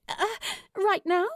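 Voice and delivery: a startled question, voice jumping up; surprised. A narrator's reading. Steed caught off guard by Lou's sudden plan, startled.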